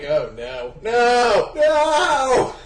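from ordinary voice to loud high cry; mock dismay, building. Reader retelling the plot twist, mounting mock dismay. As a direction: escalate each no into a yell.

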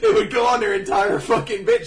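loud laughing excitement. Real, not acted. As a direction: laugh through the words. Laughing loudly.

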